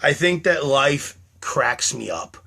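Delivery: ordinary delivery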